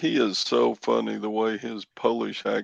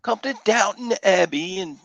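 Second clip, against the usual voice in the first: high-pitched mock British accent; hammed-up British fox-hunt voice; fake British accent; 7 semitones higher than usual. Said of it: "fake British accent".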